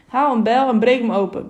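narrated command, firmly projected. A narrator's reading. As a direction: give an order to those around you. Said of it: "beval een andere stem".

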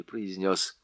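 ordinary delivery, nothing marked

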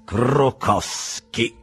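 very slow, drawn-out name; exclaiming. A narrator's reading. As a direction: announce the name theatrically, syllable by syllable. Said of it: "esclamò Settembrini".

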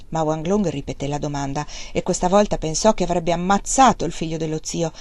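ordinary delivery, nothing marked

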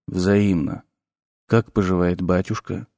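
low, soft, dark necromancer voice; sinister professor. A narrator's reading. Necromancer introducing himself to the nervous teacher, low and soft. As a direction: speak low and soft with quiet menace.

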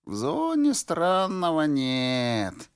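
put-on creaky, slow old man rasp. A narrator's reading. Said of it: "проскрипел старик".

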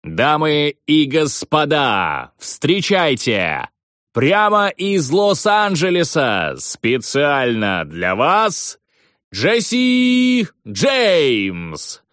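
high, slow, drawn-out arena announcer; ringmaster. Retold or imitated. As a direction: stretch every word like a ring announcer. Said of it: "ведущий прокричал моё имя".

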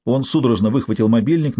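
ordinary delivery, nothing marked

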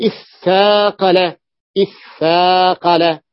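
إثاقل said slowly with a long doubled th; إثاقل as iththaaqala, slowly; doubled tha. Shown as a demonstration. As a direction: hold the ثّ in إثاقل. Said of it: "تفاعل".

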